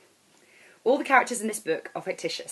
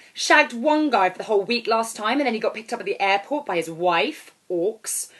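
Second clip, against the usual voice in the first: put-on 1 brash girl; 4 semitones higher, 7 dB louder than usual.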